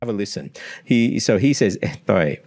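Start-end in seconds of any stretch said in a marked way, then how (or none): 1.8-2.4 "Estoy" with the S lisped as TH. Retold or imitated. Teacher imitates the student's lisped S. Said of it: "it's almost like a lisp".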